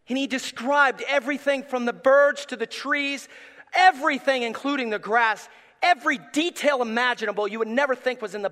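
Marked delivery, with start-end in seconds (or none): none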